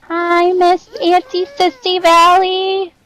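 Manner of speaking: high, slow, drawn-out hello; singsong greeting. Real, not acted. Greeting auntie mid-reading, high and drawn out.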